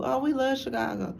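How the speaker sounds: high, sugary put-on "we love Chicago"; mock fake enthusiasm. Mocking outsiders who pretend to love Chicago, high fake gush. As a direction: lightly mimic fake locals gushing. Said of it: "they act like they cool".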